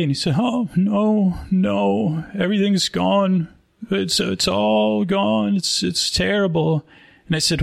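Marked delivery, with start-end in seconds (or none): 0.4-3.5 high, wailing lament, played for comedy; mock weeping. Crying man tells a concerned passerby all is lost, mock weeping. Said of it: "like, a weeping". 3.9-6.9 high, drawn-out mock wail; mock weeping. Weeping man on the street tells a stranger it's terrible, mock weeping. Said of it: "like, a weeping".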